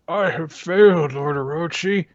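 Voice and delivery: lightly voiced exhausted ghost; dying flame spirit. Kanjuro's flame reporting failure to Orochi, feeble. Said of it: "And it says".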